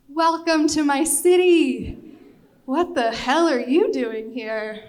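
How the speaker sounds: high, loud, slow proclamation with arms wide; host proudly presenting Venice at one in the morning; grand welcome. Retold or imitated. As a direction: announce the city like a showman. Daniel, arms thrown wide, proclaims.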